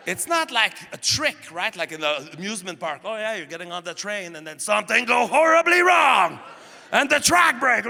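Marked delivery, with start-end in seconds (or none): 3.0-7.8 loud, high mock thrill-ride hype; hyped ride announcer. Comic mocking theme park ride hype, loud. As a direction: oversell the disaster ride like a carnival barker.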